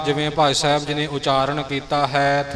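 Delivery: usual speaking voice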